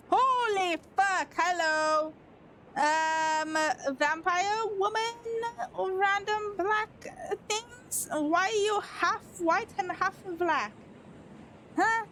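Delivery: drawn-out, high-pitched astonishment; startled reaction. Real, not acted. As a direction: stretch the words out high, baffled at what appeared. Startled reaction to vampire woman appearing.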